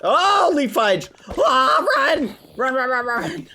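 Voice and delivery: startled high panicked shriek; being chased. Real, not acted. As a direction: scream and flee in panic. That scared me.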